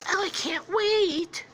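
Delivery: high, slow falsetto swoon; gushing lover. Acted. Newly engaged couple hugging, high swooning voice. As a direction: coo it in a drawn-out falsetto. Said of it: "they're like".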